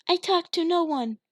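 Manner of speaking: put-on high, loud scared child voice; girl pleading with father; frightened little girl. A narrator's reading. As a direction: plead in terror.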